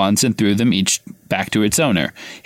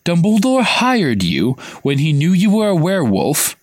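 higher, slow, aghast; Ron gasping in shock; 6 semitones higher than usual. A narrator's reading. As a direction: blurt out the shock. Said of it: "Ron gasped".

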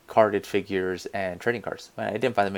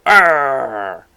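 pirate growl; 5 semitones higher, 11 dB louder than usual. Retold or imitated. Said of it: "Josh from five feet away go".

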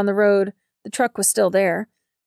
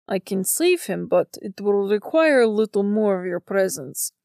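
composed supernatural bargainer, lightly characterised; a hint of an otherworldly figure offering help; understated character voice, making a measured offer; lightly voiced Belly. A narrator's reading. Narrator voices Belly in the story.

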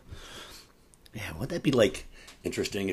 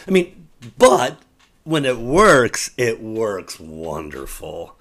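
slow emphatic; 8 dB louder, slower than usual. Real, not acted. Drawn out emphasis.

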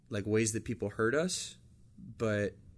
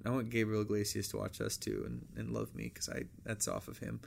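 ordinary talk

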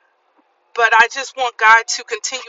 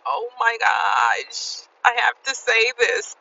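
slow, drawn-out oh my gosh, caught off guard; stunned realization; 4 dB quieter, slower than usual. Retold or imitated. Recalling the boundary she had to set, slow stunned gasp. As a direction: let the realization land slowly. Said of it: "it really felt like".

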